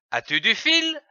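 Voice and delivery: "As-tu du fil?" with the pitch climbing to the end; rising question. Shown as a demonstration. Teacher modelling a question for young readers, rising tune. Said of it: "Avec l'intonation montante".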